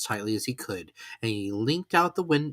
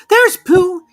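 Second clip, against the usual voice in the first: small timid animal, much higher and louder; a tiny worried piglet wishing aloud; 15 dB louder than usual. Acted. Piglet sighs to himself, stuck alone in the rain.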